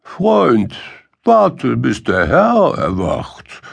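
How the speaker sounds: high "Freund, warte", then settle down; unmoved dog. A narrator's reading. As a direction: echo the donkey's advice back, unmoved. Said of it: "Der Hund bleibt regungslos und sagt".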